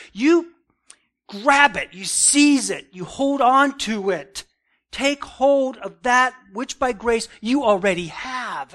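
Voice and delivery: forceful preacher urging someone on; emphatic command. Retold or imitated. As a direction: hammer each order home, stretching the key words. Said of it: "It's a command".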